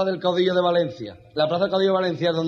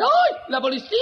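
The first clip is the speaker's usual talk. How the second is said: high, loud shriek of panic; camp alarm; 3 dB louder than usual. Acted. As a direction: squeal it like a hammed-up frightened demonstrator. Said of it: "Los mariquitas que lo ven".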